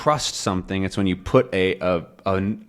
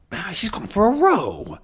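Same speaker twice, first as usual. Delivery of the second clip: put-on high, puzzled old man voice; confused old professor; 10 semitones higher than usual. Calculus puzzling over what he misheard, high confused voice. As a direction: wonder aloud, bewildered and high.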